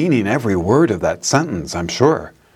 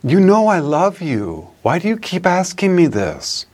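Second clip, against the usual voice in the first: higher, pleading, hurt disciple's voice; bold, hurt; 6 semitones higher than usual. Retold or imitated. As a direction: protest boldly that he's wounded. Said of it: "he says boldly".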